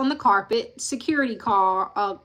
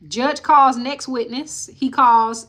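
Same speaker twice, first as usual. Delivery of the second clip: ordinary delivery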